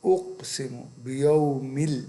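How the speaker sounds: "اقسم بیومل" drawn out very slowly; slow recitation. Shown as a demonstration. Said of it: "اب یہ".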